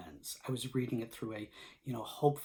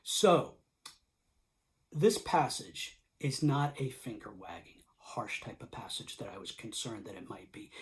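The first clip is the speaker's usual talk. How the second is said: usual speaking voice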